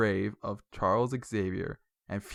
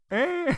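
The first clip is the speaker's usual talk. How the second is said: high beep imitation; 4 dB louder than usual. Imitating movie beeps.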